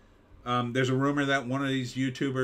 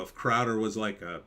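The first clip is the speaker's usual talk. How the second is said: normal talking voice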